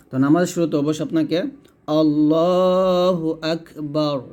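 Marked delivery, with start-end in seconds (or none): 1.9-4.2 আল্লাহু আকবার drawn out slowly, heavy lam; correct takbir recitation. Shown as a demonstration. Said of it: "এটা বলতে হবে". (ধীরে টেনে আল্লাহু আকবার)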